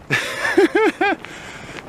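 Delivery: high, wheezy giggle; laugh. Real, not acted. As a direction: crack up mid-story. Laughing at his own story.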